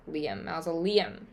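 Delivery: "mausoleum" said maw-so-LEE-um with the weight on liam; stress on liam. Shown as a demonstration. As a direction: lean on the last part, "Liam, mausoleum". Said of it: "The accent is at liam".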